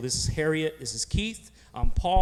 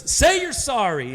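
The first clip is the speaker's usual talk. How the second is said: higher, slow, insistent mom command; angry mom demand; 9 semitones higher, 4 dB louder than usual. Retold or imitated. Mom repeating the order mid-spanking, higher and slow. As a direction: spell each word out like a spanking mom. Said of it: "She whipped my bottom and she goes".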